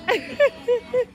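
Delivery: high laugh. Real, not acted. Laughing greeting friends.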